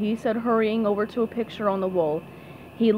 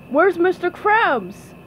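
slow, higher-pitched driver's call; lightly voiced truck driver asking; 7 semitones higher, 4 dB louder, slower than usual. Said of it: "the driver asked".

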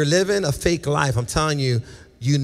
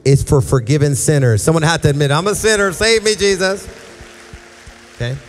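full-force preaching; 2 semitones higher, 6 dB louder than usual. Acted. Preaching to the room.

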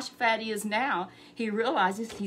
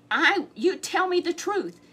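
high, loud, slow demand; angry demand; 8 semitones higher, 7 dB louder than usual. Acted. Ahab demanding truth from Micaiah, high and slow. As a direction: drag out each word in anger.

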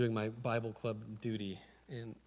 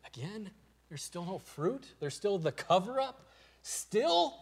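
disappointed Jesus; 8 semitones higher, 4 dB louder, slower than usual. Retold or imitated. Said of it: "he's saying".

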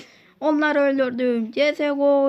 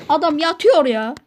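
calling out loud; 3 semitones higher, 7 dB louder than usual. Acted. Student calls out to teacher.

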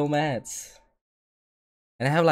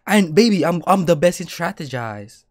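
higher and louder, bragging at her; playful boast; 4 semitones higher, 6 dB louder than usual. Real, not acted. Streamer boasting to his girlfriend, playfully defiant.

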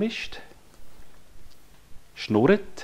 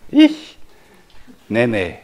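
put-on toddler voice; 6 dB louder than usual. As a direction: imitate a toddler's answer. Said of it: "jedes Mal, wenn ich gefragt habe". (hohe Kinderstimme)

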